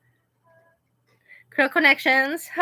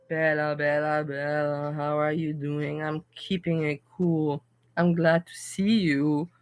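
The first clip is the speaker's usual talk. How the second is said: low greeting; 5 semitones lower than usual. Pretend.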